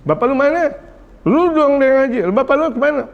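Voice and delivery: high-pitched mock interrogation; mock scolding. Preacher imitates adult questioning child about absent father, mock scolding. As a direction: demand it like a hammed-up scold. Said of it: "Ah, itu tidak bijak".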